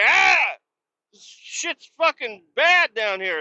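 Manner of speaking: high, drawn-out holler, imitating a coworker; mock distressed yell. Tangled worker complains from the pit, mock distressed yell. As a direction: cry out from down below in hammed-up distress.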